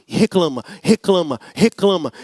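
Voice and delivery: low, droning mock complainer; grumbling mimicry. Shown as a demonstration. Pastor illustrating the chronic complainer, low droning mimicry. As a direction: drone it out like a tired grumbler. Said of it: "o reclamão é aquele que fica dez anos falando".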